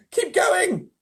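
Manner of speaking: very high, loud urging shout; crying out. A narrator's reading. Insects urging James on, high excited cry. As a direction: cheer him on loudly. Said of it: "they cried".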